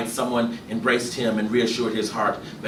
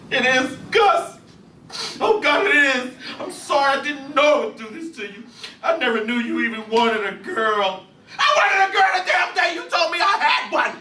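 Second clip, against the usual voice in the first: high, loud, desperate apology; a mother shouting her sorry at her husband in despair; distraught wife; 5 dB louder than usual. Acted. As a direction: cry it out to him. Imogene distraught confession.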